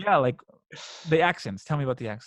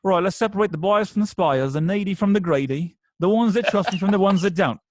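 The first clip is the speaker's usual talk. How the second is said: a Snatch-style Cockney sales patter, louder and higher; Cockney accent, pitched up and punchy; Cockney quote; 4 semitones higher, 4 dB louder than usual. Retold or imitated. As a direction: rattle it off like a Cockney street dealer. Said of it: "I'd always be like".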